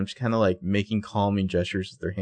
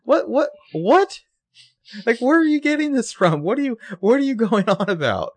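very high, squeaky-pitched bafflement; hammed-up incredulous outburst; mock incredulity; 12 semitones higher, 3 dB louder than usual. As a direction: act utterly baffled, questions climbing way up. Acted baffled reaction to critique.

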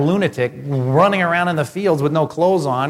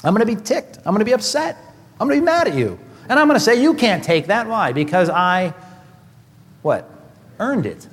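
mock indignation; 6 semitones higher than usual. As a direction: pitch climbing higher and higher in mock outrage. Mock indignant about stolen paycheck.

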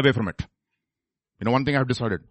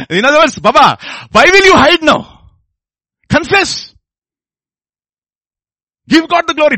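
high, loud, urgent exhortation; full-force preaching; 8 semitones higher, 7 dB louder than usual. Acted. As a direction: cry out, urging sinners to confess.

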